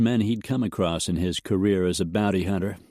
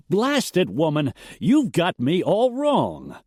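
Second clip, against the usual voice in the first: high, loud, slowed burst of frustration; exasperated outburst; 9 semitones higher, 3 dB louder than usual. A narrator's reading. A rancher bursts out at the widow accusing him, exasperated. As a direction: blurt it out, higher and louder, fed up. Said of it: "Elston burst out".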